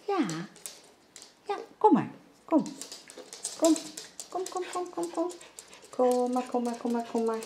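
high, soft pet-talk repeating kom; coaxing the dog. Real, not acted. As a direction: sweet-talk the dog into coming. Coaxing the dog to come along. (hoog, zacht lokken van de hond)

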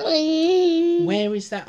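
put-on slow, high squeak for a tiny girl; tiny character's high-pitched question; squeaky high little voice. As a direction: stretch it out high and small. Character quote read aloud.

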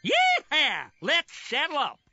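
high, loud rallying cry; cowboy whoop. Acted. Cowboy game, rallying to ride.

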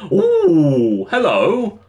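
high-pitched rising "ooh"; delighted surprise. Real, not acted. Gamer spotting items not seen before, delighted surprise. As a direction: coo at an unexpected find. Spots unexpected items in game.

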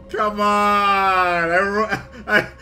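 laughing frustration. Real, not acted. Walked into fire.

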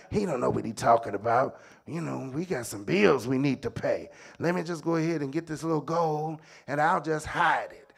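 soft, low aside to himself; furtive scheming. Acted. Servant justifying taking the gold, low furtive undertone. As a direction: talk it over low, like a secret plan.

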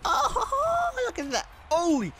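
high thrilled exclamation; excited. Real, not acted. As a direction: gush over it, pitch way up. Thrilled at new skin.

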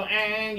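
drawn-out droning "eeeng"; imitated bee buzz. Teacher mimics a rival scout bee, long droning buzz. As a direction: mimic another dancing bee with a long whine. Second scout bee's dance noise.

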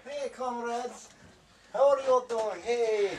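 put-on high, loud Russian accent. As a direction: greet them like a hammy Russian comrade. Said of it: "The Russian accent".

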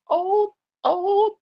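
very high, put-on old voice; mock-feeble high wail; creaky old-person voice. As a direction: squeak it like someone ancient. Jokes about being old.

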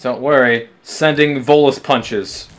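normal talking voice

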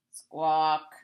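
low croaky squawk; mock bird cry. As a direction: give a rough bird squawk. Animal noise in the story.

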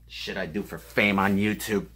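low, soft grumbling aside; muttered aside. Real, not acted. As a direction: grumble it under your breath.

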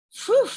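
big high exhale of relief; mock relief, loud and high; relieved phew. A narrator's reading. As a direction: blow out the tension with a loud phew. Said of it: "Calvin said".

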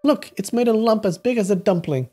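high, sustained hurt-wife voice; complaining wife. Acted. Wife showing her husband a lump, high complaint. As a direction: complain up high about the bump.

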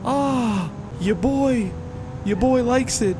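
high, slow delighted voice; excited. Real, not acted. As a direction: savor it, thrilled and high. Loving the engine sound.

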